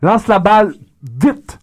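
slow, loud mock coach, Haitian-tinged accent; hammed-up manager with Haitian lilt, words drawn out; Haitian-sounding accent. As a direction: shout simple orders in a Haitian accent. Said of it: "un petit accent haïtien".

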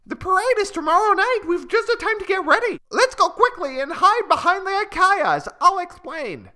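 very high, loud and fast; excited call. Acted. A little bird calling out its plan to the others, very high and loud. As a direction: burst out with a sudden bright idea. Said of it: "a little bird calls out".

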